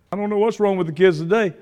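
ordinary delivery

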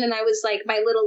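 ordinary talk

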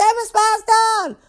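high excited squeal; screaming inmates. Acted. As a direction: squeal her name like an adoring fan. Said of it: "women screaming".

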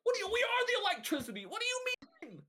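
very high, squealing indignation; a pompous boss losing it in disbelief; shrieking president. Acted. A company president squeals at the players, very high.

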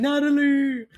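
high, drawn-out mock wail; loud hammed-up cry of the name; yelling. Fiance on his knees in front of her, mock yelling.

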